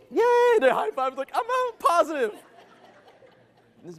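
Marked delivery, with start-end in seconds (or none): 0.1-2.3 mock cheer. Mocking an optimist's cheer.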